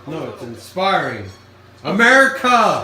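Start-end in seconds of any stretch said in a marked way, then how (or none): 1.8-2.8 loud, high laughing cheer; patriotic shout. Pretend. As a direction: yell it like a proud fan. Mock patriotic cheer over hot dogs.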